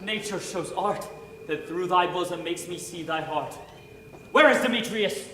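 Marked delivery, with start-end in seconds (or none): 4.3-5.3 much louder and higher, shouting with a laugh; wild outburst. Acted. Enchanted Lysander calling for his rival's death, wild laughing shout. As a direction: rage half-laughing like a man possessed. Bewitched Lysander raging at Demetrius.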